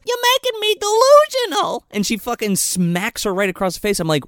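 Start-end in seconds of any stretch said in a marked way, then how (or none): 0.1-1.9 high-pitched hammed-up fit; mock outrage. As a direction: screech it high, mimicking a furious woman. Acts out the angry slapper.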